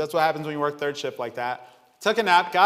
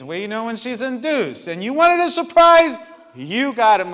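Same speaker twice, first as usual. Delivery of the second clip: louder, higher exasperated back-and-forth; 7 semitones higher, 7 dB louder than usual. Retold or imitated. Preacher replaying his argument with the Lord, loud. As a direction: shout it like arguing with heaven. Arguing with God in story.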